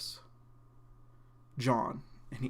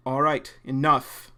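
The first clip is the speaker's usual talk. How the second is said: louder, commanding stop; stern call; 8 dB louder, slower than usual. Acted. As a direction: cut the fight short firmly. Vaughn breaks up the fight.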